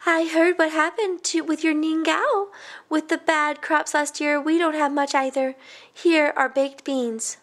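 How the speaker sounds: kindly neighbor woman, voiced high and gentle; a sympathetic older lady offering help; high market woman. Vegetable seller to the Changs, voiced high and kind.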